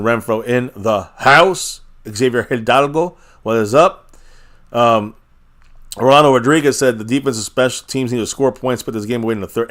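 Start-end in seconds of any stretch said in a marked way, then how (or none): none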